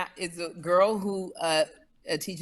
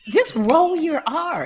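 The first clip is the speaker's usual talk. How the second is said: coaxing coach; 8 semitones higher, 4 dB louder, slower than usual. Real, not acted.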